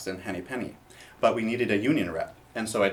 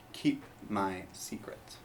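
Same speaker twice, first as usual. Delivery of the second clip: whisper; 2 semitones lower, 10 dB quieter, much slower than usual. Acted. Fox reminds interviewer of secret.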